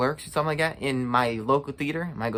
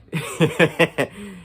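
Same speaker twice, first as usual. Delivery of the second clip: short laugh; 4 semitones higher than usual. Real, not acted. Laughing at his own joke.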